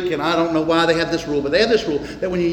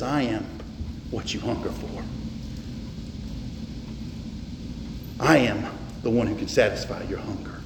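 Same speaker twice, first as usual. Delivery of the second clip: hushed voice of Jesus; 9 semitones lower, 11 dB quieter than usual. Acted. Preacher voicing Jesus to the congregation, hushed and low. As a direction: drop low and soft, almost a murmur.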